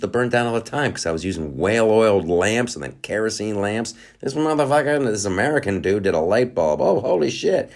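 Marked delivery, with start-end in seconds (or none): 4.2-7.7 mock amazed onlooker. Foreign onlooker amazed at inventions.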